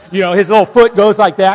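loud thumping bit. Acted.

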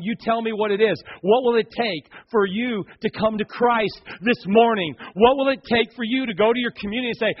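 ordinary talk, nothing marked

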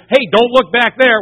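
loud, high call of alarm; urgent warning. Acted. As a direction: call it out sharply to stop someone. Acted warning to Lot's wife.

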